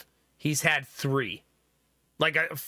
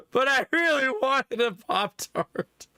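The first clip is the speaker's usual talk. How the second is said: put-on high, slow, teary little-kid whine; mock sobbing child voice; whiny toddler; 7 semitones higher, slower than usual. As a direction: plead like a crying three-year-old. Child voiced crying over Pop-Tarts.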